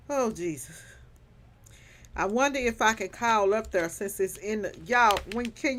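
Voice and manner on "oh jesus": breathy whispered Oh Jesus; whisper, worn out from laughter. Real, not acted.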